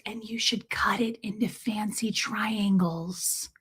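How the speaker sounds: slow, breathy secret whisper; mom's whisper. Retold or imitated. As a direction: whisper the tip slowly, like a secret. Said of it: "she does whisper sometimes".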